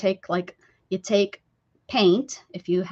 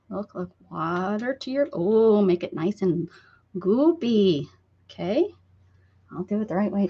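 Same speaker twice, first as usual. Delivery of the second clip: normal talking voice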